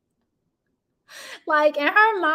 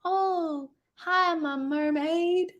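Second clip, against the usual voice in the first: slow, higher mock mama voice; lightly voiced slow mama greeting; imitating the mama; 4 semitones higher, 4 dB quieter, much slower than usual. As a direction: mimic the mama's slow greeting. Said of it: "she gonna say".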